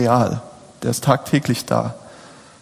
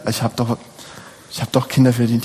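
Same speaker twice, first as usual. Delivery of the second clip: normal talking voice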